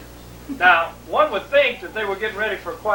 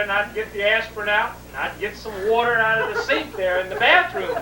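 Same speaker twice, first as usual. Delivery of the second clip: ordinary delivery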